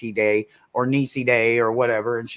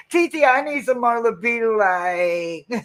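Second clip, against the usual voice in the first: whiny plea; 8 semitones higher than usual. Retold or imitated. Voicing herself to aunt.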